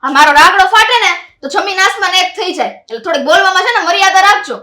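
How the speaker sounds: put-on young bride warns her sister-in-law off, loud and defiant; defiant retort. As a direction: talk back loudly and cheekily. Bride answers back in quarrel.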